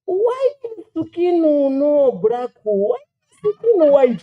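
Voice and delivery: put-on high, slow, baffled Japanese accent; mock Japanese child. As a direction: imitate a puzzled Japanese kid working it out.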